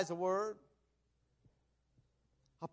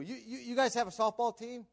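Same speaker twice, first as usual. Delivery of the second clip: ordinary talk, nothing marked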